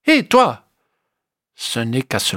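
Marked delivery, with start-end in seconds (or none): 0.1-0.7 high, loud call to get attention; a high hey you called out. A narrator's reading. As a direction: shout up at him. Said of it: "Eddie lui crie".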